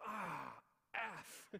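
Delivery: loud, high cry cut to a censored curse; hammed-up shock, swallowing the swear; imitating startled yelp. Wife hit by flying cream, mock loud startled curse. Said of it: "her response was".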